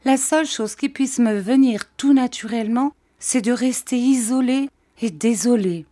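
put-on high plaintive old woman; plaintive old widow. A narrator's reading. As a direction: moan it like a self-pitying widow. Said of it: "repartit mistress Gummidge".

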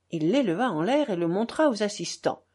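ordinary delivery

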